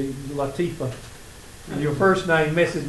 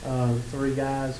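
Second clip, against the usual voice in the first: ordinary talk, nothing marked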